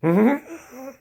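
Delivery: sarcastic mm-hmm. Pretend. Sarcastic mock agreement.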